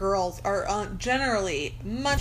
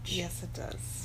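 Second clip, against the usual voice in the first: whispering; 2 semitones lower, 9 dB quieter, slower than usual. Real, not acted. Whispered joke at start.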